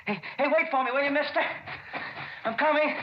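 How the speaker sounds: high, urgent call to wait; excited high-pitched hailing; eager calling out. Acted. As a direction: call ahead to the driver, afraid he'll leave. Running after a stopped car.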